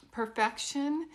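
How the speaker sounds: ordinary talk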